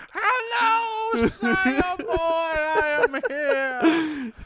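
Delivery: put-on very high, very slow grand old Brit; a pompous belly announcing itself from the t-shirt; old British stomach voice. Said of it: "Talks like an old-ass British dude".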